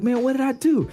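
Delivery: high, defensive complaint; imitated baffled protest. Wiggins answering his upset teammate, high baffled imitation. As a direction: protest innocence with pitch jumping up.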